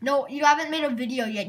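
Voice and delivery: ordinary talk, nothing marked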